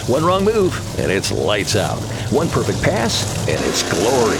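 punchy, rising promo voice; hype ad read. Acted. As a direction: sell the race night with energy. Reads a hype race ad.

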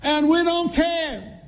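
high, slow preaching cry, pitch lifted well up; impassioned preaching. Acted. Preacher crying out to his congregation, high drawn-out preaching. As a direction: wail it out to the pews, slow and high.

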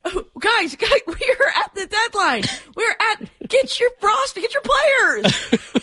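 hammed-up plea to the clubs; mock plea to teams. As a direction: beg the teams like a desperate fan. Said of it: "But my problem with the home run derby is, hey, guys".

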